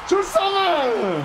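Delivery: voix haute et lente, résignée; traîne-le en montant la voix; dépité aigu. Real, not acted. Défaite en finale. (je le savais aigu et traînant)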